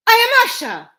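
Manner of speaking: high, loud bold declaration; proud announcement. Acted. Usha answering the voice behind the wall, high and bold. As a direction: claim your name.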